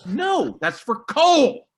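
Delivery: high no, slow stressed coal; exasperated retold correction; blunt correction. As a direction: spell it out to a fool. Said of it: "And I'm like".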